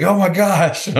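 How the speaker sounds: imitating a thrilled fan; starstruck. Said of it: "He's like".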